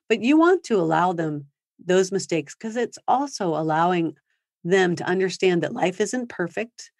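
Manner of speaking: ordinary delivery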